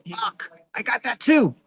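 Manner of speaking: high, slow, alarmed self-diagnosis; mock dismay. Reading about manic states, he is stricken: high and slow. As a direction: sound stricken as it hits home. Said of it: "I was like".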